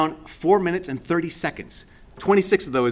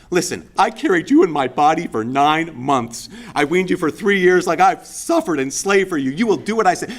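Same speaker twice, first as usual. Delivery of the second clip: guilty mom; 4 dB louder than usual. Acted. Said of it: "that can sound like one of those guilty moms".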